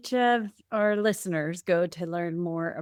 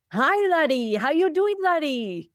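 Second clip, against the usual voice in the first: higher, louder put-on Irish lilt; attempted Irish accent; 7 semitones higher, 3 dB louder than usual. Shown as a demonstration. Host shows guests her Irish accent, playful bad brogue. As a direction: give it a badly faked Irish brogue. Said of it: "bring out my Irish accent".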